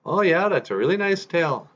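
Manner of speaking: very high, gushing reply; polite crow. Acted. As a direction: humour a show-off in a high voice. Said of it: "on Deg looks at his tail and he says".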